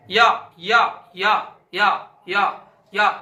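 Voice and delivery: या said loud and drawn out, tongue rising to the palate; demonstrating ya sound. Shown as a demonstration. Teacher sounding out या for the class. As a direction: repeat या clearly for students. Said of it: "या का क्या समझेंगे".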